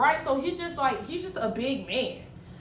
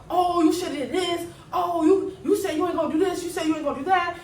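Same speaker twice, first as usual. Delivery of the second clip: higher, louder mock ranting; retold angry yelling; 6 semitones higher, 4 dB louder than usual. Worker imitating an angry coworker yelling at her, loud mock rant. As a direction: imitate a man loudly scolding you. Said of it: "this man is getting really loud".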